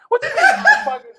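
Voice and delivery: high, loud, cheeky shout; mock indignant retort. Answering the managers with a high, loud cheeky shout. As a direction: fire it back at the boss, laughing.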